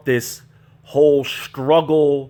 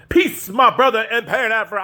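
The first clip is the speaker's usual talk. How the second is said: mock greeting; 8 semitones higher than usual. Mocking the school's graduates with their greeting. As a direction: ham up the pompous brotherly salute. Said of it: "of setting talking like this".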